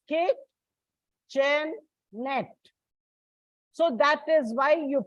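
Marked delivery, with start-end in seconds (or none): 0.1-2.5 "kitchen net" slowly split into separate syllables. Shown as a demonstration. Teacher showing children how it is pronounced, "kitchen net" broken slowly into syllables. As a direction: say "kitchen net" in spaced-out pieces, with a gap before "net". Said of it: "When you pronounce it is".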